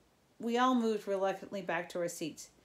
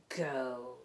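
snarling; 6 semitones lower than usual. A narrator's reading. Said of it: "he snarled".

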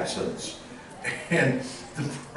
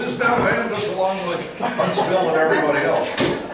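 announcer voice; 4 semitones higher, 3 dB louder than usual. Acted. Announcing guest to crowd.

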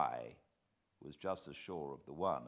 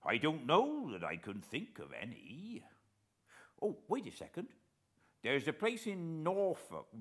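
put-on higher, louder respectful old sailor; lightly voiced naval veteran, eager to help; retired officer answering gentlemen politely; old coast guard man; 4 semitones higher, 4 dB louder than usual. A narrator's reading.

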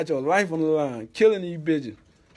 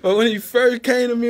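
usual speaking voice

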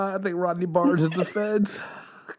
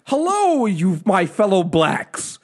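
mock-earnest infiltrator, higher and drawn out; 5 semitones higher, slower than usual.

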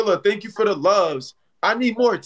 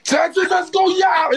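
high, excited victory cry; whooping celebration yell; hype shout; 11 semitones higher than usual. Real, not acted. Celebrates in live battle.

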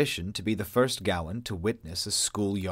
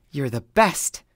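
louder, happy exclamation; exclaimed; 5 semitones higher, 6 dB louder, slower than usual. A narrator's reading. Haley thanking Ava, louder happy exclaim. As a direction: gush thanks. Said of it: "Haley exclaimed".

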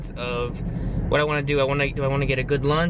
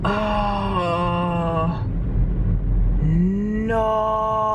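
dismayed oh no; 6 semitones higher than usual. Real, not acted. Finds the shop closed.

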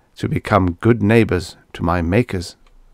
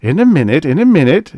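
louder, higher, brushing someone off; impatient; 7 semitones higher, 6 dB louder than usual. A narrator's reading. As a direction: put them off impatiently, pitch raised. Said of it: "said Bates impatiently".

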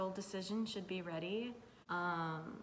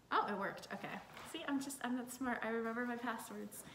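usual speaking voice

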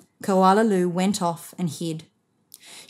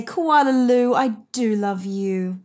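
mother's tender refrain; 3 semitones higher than usual. A narrator's reading.

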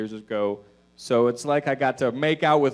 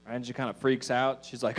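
usual speaking voice

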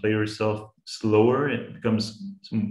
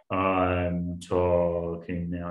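very slow and lower, like a slowed recording; 4 semitones lower, much slower than usual. Shown as a demonstration. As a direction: drag the words out low like audio slowed down. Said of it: "it would seem like I'm saying".

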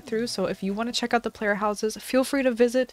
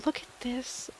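hushed awe; 3 semitones higher, 8 dB quieter than usual. Real, not acted. Awed at island view.

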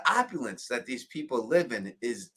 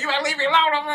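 mock Kentucky accent; 5 dB louder, much faster than usual. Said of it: "he's got a Kentucky accent".

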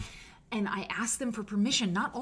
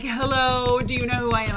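haughty hello; 3 semitones higher, 11 dB louder than usual. Pretend. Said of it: "No, I just meant".

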